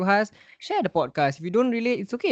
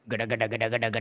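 low rhythmic gada sound effect; imitated noise; 6 semitones lower, 5 dB quieter, faster than usual. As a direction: mimic a machine sound with the mouth. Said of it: "the sounds that you hear during her job is like".

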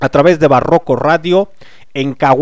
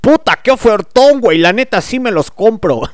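meme excitement; 6 semitones higher, 3 dB louder than usual. Retold or imitated. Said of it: "la del meme".

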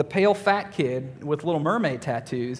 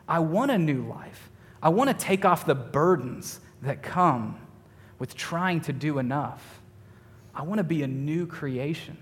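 sermon appeal; 4 dB quieter than usual. Acted. Pastor urging listeners toward a new life. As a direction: draw the listeners in to Jesus. Preaching application.

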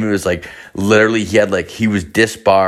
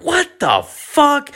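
much higher, drawn-out shocked cry; shocked buddy; much slower than usual. Retold or imitated. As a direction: stretch it out in disbelief, way up high. Buddy learns lawyer fled.

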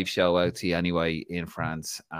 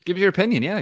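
mock echo; 11 semitones higher, 4 dB louder, much faster than usual. Mimicking S1's line back.

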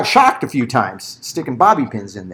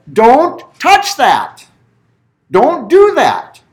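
缓慢严厉地一字一顿警告; 放慢语速，像对婴儿严肃告诫; stern warning to baby; 8 semitones higher, 6 dB louder, much slower than usual. Retold or imitated. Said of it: "And you have to tell this child".

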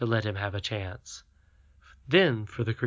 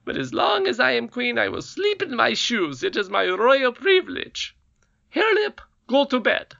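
high, loud imperious squeal; triumphant goblin queen; 12 semitones higher, 5 dB louder than usual. A narrator's reading. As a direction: lord it over them. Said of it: "cried the Queen triumphantly".

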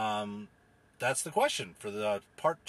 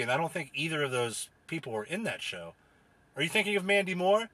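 ordinary talk, nothing marked

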